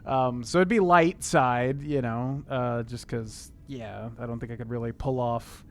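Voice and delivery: normal talking voice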